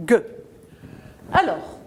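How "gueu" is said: G said as the hard sound "gueu"; hard G phoneme. Shown as a demonstration. Teacher gives children the phoneme, hard G. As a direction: say G as a hard stop, not its letter name. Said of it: "Le phonème".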